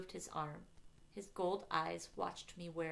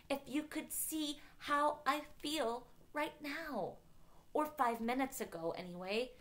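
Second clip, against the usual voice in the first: higher-pitched, emphatic venting; frustrated explanation; 5 semitones higher than usual. A narrator's reading. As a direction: argue it out in exasperation.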